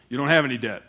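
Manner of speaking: lightly voiced gruff father; a hint of a plain-spoken working man; gruff dad. Dad turning down his son's repayment plan, lightly gruff. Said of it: "just kinda gruff and hard worker".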